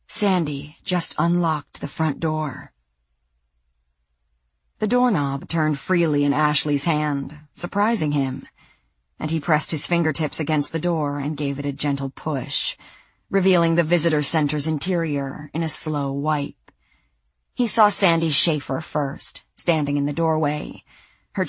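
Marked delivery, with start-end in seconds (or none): none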